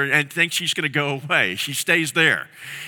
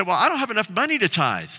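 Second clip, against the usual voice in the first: higher, quicker voice of people making excuses; lightly voiced anxious Israelites; worried excuse; 7 semitones higher than usual. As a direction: whine that you can't afford it.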